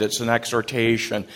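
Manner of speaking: ordinary talk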